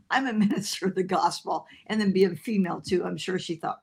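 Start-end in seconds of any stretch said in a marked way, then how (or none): 0.1-1.7 breaking into laughter mid-line; laughing. Retold or imitated. To the bartender at the airport bar, laughing helplessly. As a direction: giggle through the words. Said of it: "I'm going".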